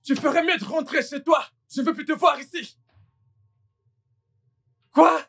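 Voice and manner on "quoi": shocked cry. Acted. Cri de surprise choquée.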